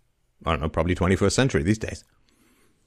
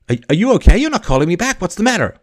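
high-pitched imitation of a fretting caller; worried girlfriend; 10 semitones higher, 5 dB louder than usual. Girlfriend on his machine when he hasn't called back, high and worried. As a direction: pitch it way up, mock anxious and pleading. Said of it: "Now I'm really getting concerned".

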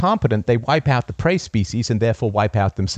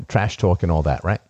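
ordinary delivery

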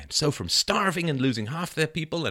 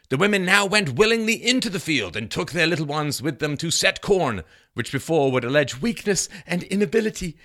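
firm, raised reading voice; declaimed historical quote; 4 semitones higher, 4 dB louder than usual. Acted. Reading Bradford on the women's work, declaimed. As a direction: read it out like a proclamation. Reads Bradford's account aloud.

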